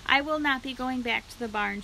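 ordinary delivery

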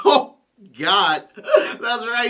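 laughing out the words. Acted. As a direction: burst into laughter mid-sentence. Laughing at memory on phone call.

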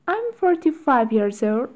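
quick, natural-speed repeat; natural fast speed. Shown as a demonstration. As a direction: rattle it off at real speed. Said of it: "faster drill repetition".

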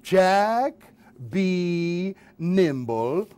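ordinary talk

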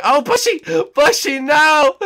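real alarmed yell at a friend; shocked shout. Real, not acted. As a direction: yell as he falls. Player falling to his death.

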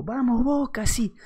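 high-pitched mock cheer, not full volume; imitated fan yell. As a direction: cheer like a crazed football fan. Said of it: "gritaba el loco".

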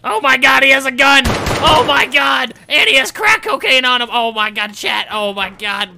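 high, loud frantic yelling; panicked shout. Pretend. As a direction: scream it in mock panic.

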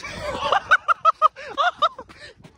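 squealing laugh after a slip; high squealing laugh. Real, not acted. Slipping on the hill.

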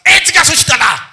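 high, loud shouting mother; hammed-up shout of a mother; mock mother's shout. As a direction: scold loudly like a noisy mum. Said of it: "Tout maman qui a dit".

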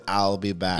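low, soft imitation of an Austrian accent; Arnold. Friend showing how the actor's accent sounded, Austrian-accented imitation. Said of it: "But his accent".

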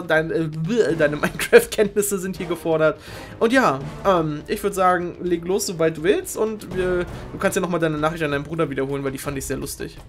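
normal talking voice

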